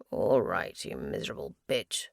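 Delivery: low, soft, grim mutter; low muttered curse. A narrator's reading. Max cursing the place, low, grim muttering. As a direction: growl it under your breath. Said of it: "Max muttered".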